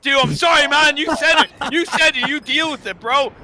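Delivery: loud, high teasing jab. Real, not acted. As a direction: rub it in with a grin. Teasing co-caster about the curse.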